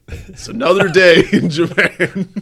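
loud, high, laughing quote. Retold or imitated. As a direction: crack up mid-line. Absurd quote, laughing through it.

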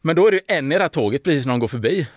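ordinary talk, nothing marked

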